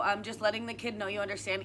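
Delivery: ordinary talk, nothing marked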